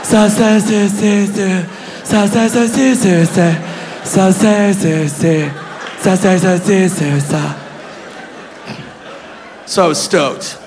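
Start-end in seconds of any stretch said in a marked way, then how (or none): 0.0-7.6 hushed, sibilant elf-speak syllables; mock Elvish murmuring; elf princess. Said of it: "She was like".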